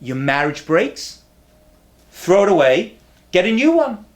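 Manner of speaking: ordinary talk, nothing marked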